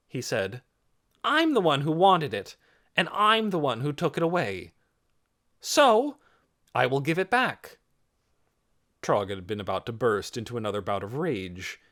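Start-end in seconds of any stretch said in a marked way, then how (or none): 1.2-7.8 higher, louder, determined voice; resolute declaration. A narrator's reading. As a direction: take the blame publicly. Boy takes blame before crowd.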